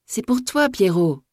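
high, insistent call; calling to dog. A narrator's reading. Women calling to the dog in the well, high insistent call. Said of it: "Elles avaient beau spécifier".

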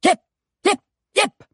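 short, sharp separate calls; coachman's urging yelps; urging on a horse. A narrator's reading. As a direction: drive the horse on with quick cries.

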